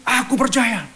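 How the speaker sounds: crying out. Retold or imitated. Father crying out to Jesus, high desperate cry. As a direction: lift the voice into a desperate shout of faith. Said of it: "dengan berteriak".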